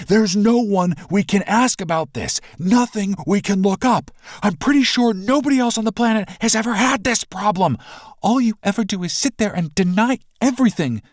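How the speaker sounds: high, loud frustrated pleading; arguing loudly and fed up; heated argument. Acted. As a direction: push back hard, exasperated.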